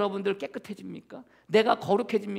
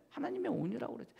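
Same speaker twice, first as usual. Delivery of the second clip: 하나님의 온유라고 그랬죠 repeated much softer and quicker, rising on 하나님의; 14 dB quieter, faster than usual. Shown as a demonstration. Preacher softly repeats his point when the congregation asks 네?, hushed. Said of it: "네?".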